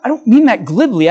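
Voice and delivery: ordinary talk, nothing marked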